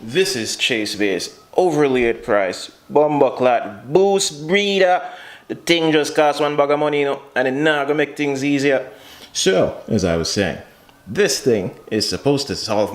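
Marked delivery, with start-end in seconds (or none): 2.9-8.9 cursing in Jamaican Patois, higher pitched; native-tongue cursing. Real, not acted. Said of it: "curse in my native tongue".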